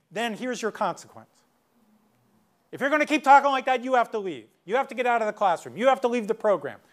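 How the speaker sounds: high, loud rapid-fire ultimatums; heated adult ordering a kid out; power-struggle scolding. Retold or imitated. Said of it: "adults get into the power struggles".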